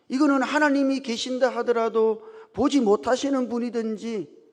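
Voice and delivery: 높고 크게 따져 묻는 청년 흉내; 억울한 듯 목청 높여 항변하듯 연기. Retold or imitated. 청년이 따지는 말을 연기. (목소리 높여 따지기)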